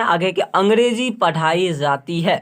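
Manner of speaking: ordinary delivery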